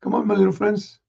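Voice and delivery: usual speaking voice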